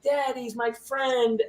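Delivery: put-on high, very slow sweet child's voice; little daughter voice. Little daughter thanking dad for the bracelet, high and slow. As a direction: say it like a small sweet girl, drawn out. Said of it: "she was like".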